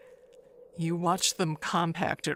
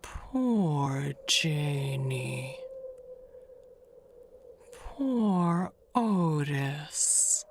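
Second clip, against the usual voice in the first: slow lament; 4 semitones lower, much slower than usual. Acted.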